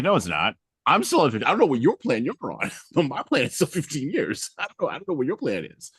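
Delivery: high, loud, playful defiance; defiant retort. Retold or imitated. To the instructor who promoted him, high laughing defiance. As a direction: insist on it, laughing and teasing.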